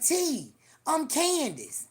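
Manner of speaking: drawn-out call of her own name; slow high call. Retold or imitated. Candace calls after T at the door, slow and loud.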